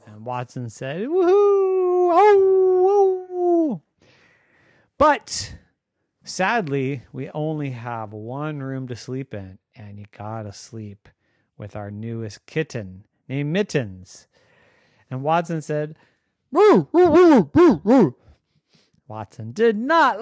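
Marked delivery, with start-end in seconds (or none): 1.0-3.8 high, loud howling woohoo; long drawn-out happy doggy howl. Acted. Voicing the dog's delighted reply. 16.5-18.2 high, loud short doggy woos; dog woofing. Acted. Voicing dog dismayed about kitten.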